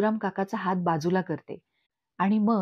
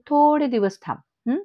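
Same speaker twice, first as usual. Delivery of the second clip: derisive singsong repeat with a questioning tail; mocking echo; 6 semitones higher, 6 dB louder than usual. A narrator's reading. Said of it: "Meenakshi काकू हसते आणि म्हणते".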